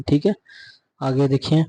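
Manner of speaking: ordinary delivery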